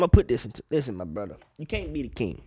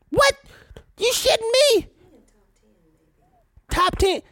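high, sharp disbelief; shocked disbelief; 3 dB louder than usual. Real, not acted. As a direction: sound floored by it.

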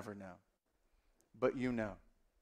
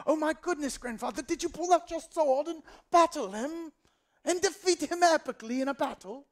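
very high, louder, excitable grandchild; accented grandson; 13 semitones higher, 4 dB louder than usual. Acted. Grandson interrupting grandfather's battle story, high excited put-on accent. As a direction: gush in a piping put-on accent. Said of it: "I don't know why they have that accent".

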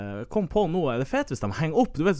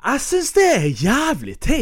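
indignant; 11 semitones higher, 6 dB louder, much slower than usual. Real, not acted. Indignant outburst.

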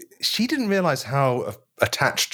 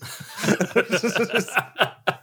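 high laugh; 9 semitones higher than usual. Real, not acted. Big laugh at own joke.

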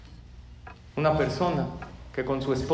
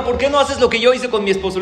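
louder, higher, insistent question; emphatic advice; 6 semitones higher, 7 dB louder than usual. Acted. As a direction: push the advice on your friend, loudly. Joke, friend eagerly advising friend. (consejo enfático, en voz más alta)

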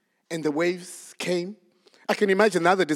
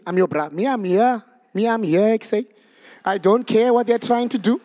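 attempted Mitchell's Plain accent, increasingly fired up; 3 semitones higher, 5 dB louder than usual. Peter pledging to back Jesus, Mitchell's Plain accent. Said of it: "Did I get that right? Am I trying?".

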